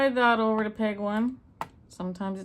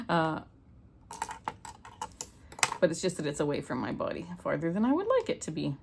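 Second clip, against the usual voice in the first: ordinary talk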